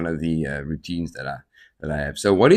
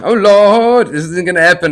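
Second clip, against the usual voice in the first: much higher, louder alarmed cry; imitating panic on the motorbike; mock panic; 9 semitones higher, 8 dB louder than usual. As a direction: cry out as the bike runs away. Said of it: "I'm like".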